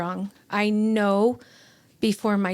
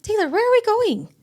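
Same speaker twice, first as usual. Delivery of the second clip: high, loud retold demand for answers; raised, high-pitched confused demand; insistent questioning; 13 semitones higher, 6 dB louder than usual. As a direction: press a friend to explain, pitched way up. Questioning friend in retold scene.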